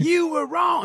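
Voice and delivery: high, loud mock jeer; heckling crowd. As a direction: cry out like a scolding audience. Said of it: "And they go".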